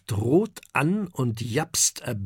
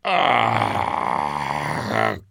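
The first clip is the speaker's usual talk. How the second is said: long, low, rumbling burp; mighty burp; 8 semitones lower, 3 dB louder than usual. A narrator's reading. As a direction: let out a big drawn-out belch. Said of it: "ein mächtiger Rülpser". (mächtiger Rülpser)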